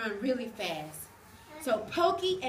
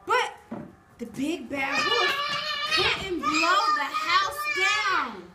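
slow dramatic narration; 6 semitones higher, 8 dB louder than usual. A narrator's reading. Wolf blowing, drawn out.

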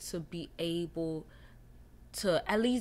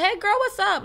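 casual greeting; 13 semitones higher, 10 dB louder than usual. Retold or imitated. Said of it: "Be like, be cool about it".

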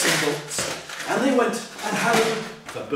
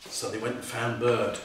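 ordinary delivery, nothing marked

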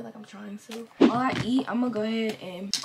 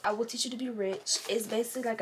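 ordinary talk, nothing marked

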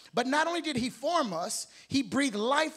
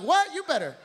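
mock surprise; 4 semitones higher, 5 dB louder than usual. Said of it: "Stacy's back there like".

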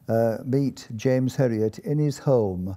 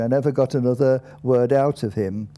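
plain reading voice

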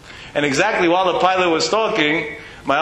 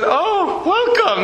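much higher, bright mock greeting; mock surprised welcome; much slower than usual. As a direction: sing out a surprised "welcome" in fun.

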